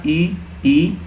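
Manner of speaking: "ई, ई" spoken softly as short i then long ii, tongue forward; vowel demo. Shown as a demonstration. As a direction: show the class "ई, ई" with the tongue pushed to the front. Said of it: "आप खुद pronounce उच्चारण करना". ("ई, ई" को जीभ आगे रखकर साफ़ उच्चारण करना)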